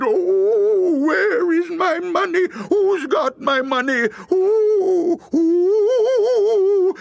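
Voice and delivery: very high, loud ghost cry; piercingly high, drawn-out howl; ghostly wail. A narrator's reading. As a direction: wail it like a dead woman's ghost. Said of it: "ghost of the dead woman cried".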